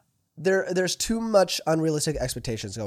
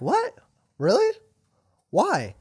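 mock surprise; 7 semitones higher, 4 dB louder, slower than usual. Friend shocked by restaurant complaint.

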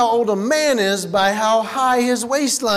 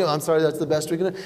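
1 mock agent; 5 semitones lower, 4 dB quieter, faster than usual. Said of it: "agent repeats line".

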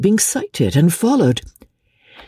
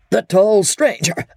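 high, urgent outburst broken by sneezy coughs; stammering between sneezes; 11 semitones higher than usual. A narrator's reading. Chief questioning his men between two sneezes, spluttering. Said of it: "managed to stammer between two sneezes".

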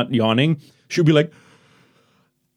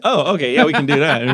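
ordinary talk, nothing marked

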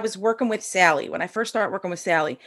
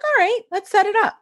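high, chirpy agreement; breezy Sally; 13 semitones higher than usual. Retold or imitated. Quoting coach Sally agreeing to a session, high and bright. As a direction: toss it off brightly like the easygoing coach. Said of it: "She's like".

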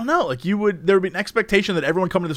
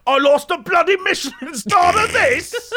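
much higher shouted mock British chef rant; Gordon Ramsay; 11 semitones higher, slower than usual. Gordon Ramsay raging at the sixty-four sauces, hammed-up shouting. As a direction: do an over-the-top Gordon Ramsay yell. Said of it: "Gordon Ramsay would have been up in there and be like".

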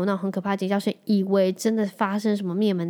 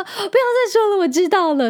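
high, loud mock whine of a fed-up husband; hammed-up husband, higher and louder, pleading enough already; exasperated husband; 11 semitones higher, 6 dB louder than usual. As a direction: squeal it like a husband begging her to stop. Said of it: "他说".

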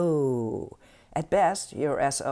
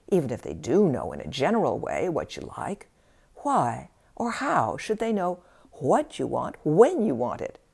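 usual speaking voice

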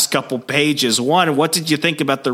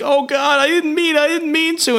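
panicked, much higher strained voice; a high squeal of guilty panic. Retold or imitated. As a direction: stabber horrified at what he did, panicked and much higher. Brawler panics after stabbing.

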